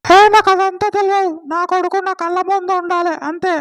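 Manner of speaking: high-pitched wife berating her husband; mother character voice. Acted. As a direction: play the angry mother defending her son. Man voices angry mother character.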